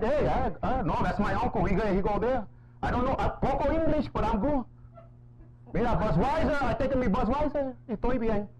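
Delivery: high, hammed-up English Spanish accent as his uncle; uncle's English Spanish accent. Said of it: "That was my uncle back in the days".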